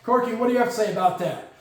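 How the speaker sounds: normal talking voice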